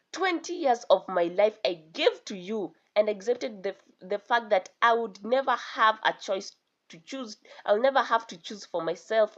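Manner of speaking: plain reading voice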